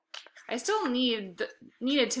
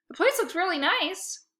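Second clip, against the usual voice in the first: much higher, gushing compliment; eager flattery; 9 semitones higher than usual. Acted. Boy flattering dad about his messy place. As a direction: lay the praise on thick.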